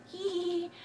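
high breathy hee hee giggle. Real, not acted. Giggling to camera.

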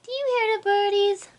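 high, bright coo to her cat; sing-song baby voice asking the cat to listen; baby talk to pet. Acted. Owner coos to her cat about birdies, high baby talk.

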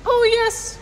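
very high, loud squeak; piping voice. A narrator's reading. Tiny mayor confirming to Horton, loud piping squeak. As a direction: pipe up eagerly like a tiny creature. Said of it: "piped the voice".

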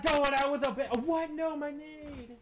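high loud dismay, slowing; frantic yell. Real, not acted. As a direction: wail as the plan fails. Dying, grenade failing.

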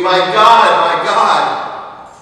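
preacher voicing the scream from the cross, anguished. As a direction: tear it out of the chest in anguish. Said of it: "I hear a scream".

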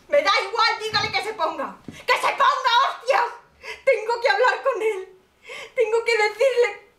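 high, loud, crying, half-laughing shouts; hysterical. Acted. Desperate mother screaming at the officer to put her son on.

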